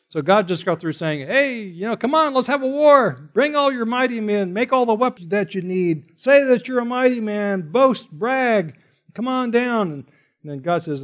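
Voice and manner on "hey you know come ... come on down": God sarcastically daring the nations; sarcastic taunt. Retold or imitated. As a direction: goad them to come and fight. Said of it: "God's being sarcastic here".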